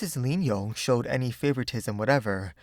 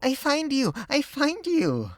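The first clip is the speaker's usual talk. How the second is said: put-on joyful little girl; 4 dB louder than usual. A narrator's reading. Little girl finding her friend after school, high and joyful. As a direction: sing out in delight. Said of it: "cried she joyfully".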